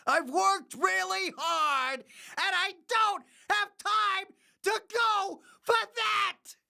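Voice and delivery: building into a high-pitched comic rant; exaggerated high, strained protest; mock outburst. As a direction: work yourself up into a squealing tirade. Acted rant answering listener question.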